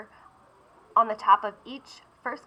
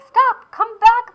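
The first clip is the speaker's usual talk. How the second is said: much higher, louder, slow call; yelling; 13 semitones higher, 9 dB louder, slower than usual. Acted. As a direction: bellow after him. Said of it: "the artist yelled".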